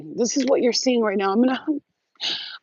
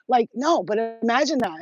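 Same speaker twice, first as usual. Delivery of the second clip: ordinary delivery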